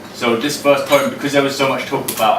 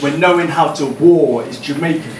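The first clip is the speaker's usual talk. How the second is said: raised, ringing performance poem voice; higher and louder performance reading; spoken word poem; 4 semitones higher, 4 dB louder than usual. Acted. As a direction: deliver each line to the room like a slam poem.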